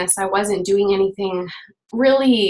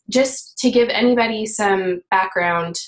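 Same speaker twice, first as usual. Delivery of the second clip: normal talking voice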